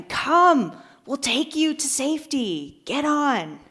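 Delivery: high, stretched-out call to someone below; mock yell. Helicopter crew to man on the roof, mock yelling. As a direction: imitate rescuers yelling down from overhead. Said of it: "they yell at him".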